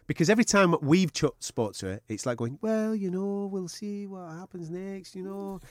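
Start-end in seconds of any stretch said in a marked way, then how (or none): 2.6-5.6 soft, high, meek Jojo voice; Jojo impression. Host mimics polite Jojo in post-fight interview, soft and meek. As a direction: mimic her humble, modest interview talk. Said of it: "Good impression".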